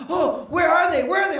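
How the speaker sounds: put-on high, eager little boy voice; excited kid. Son arriving at school looking for friends, high and excited. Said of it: "he was so excited".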